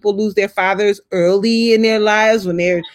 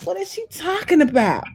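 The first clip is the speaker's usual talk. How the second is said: bewildered; 8 semitones higher than usual. Retold or imitated. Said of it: "I'm like".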